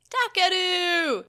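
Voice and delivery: long, high, loud call. A narrator's reading. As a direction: call out across the forest. Calling out for brother.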